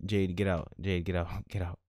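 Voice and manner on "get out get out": ordinary talk, nothing marked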